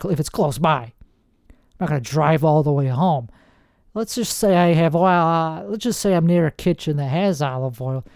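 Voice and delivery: ordinary delivery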